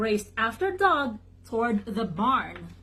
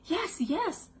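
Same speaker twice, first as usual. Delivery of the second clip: high, softer duck voice; high little quacking reply; quacked; 8 semitones higher, 4 dB quieter than usual. A narrator's reading. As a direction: answer like an eager duck, pitched up. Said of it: "Doug quacked".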